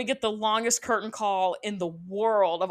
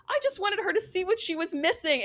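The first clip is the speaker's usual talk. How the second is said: high put-on voice of a defensive mom; mock self-defence; 10 semitones higher than usual. Mom explaining the tickets to coach, mock self-defence. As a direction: imitate someone pleading their case, pitched way up.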